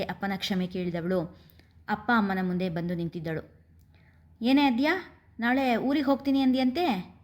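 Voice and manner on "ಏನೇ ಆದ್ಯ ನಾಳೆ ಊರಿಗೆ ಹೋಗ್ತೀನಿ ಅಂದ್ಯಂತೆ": teasing with a giggle. A narrator's reading. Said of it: "ಮುಸಿ ಮುಸಿ ನಗುತ್ತಲೇ ಕೇಳಿದಳು".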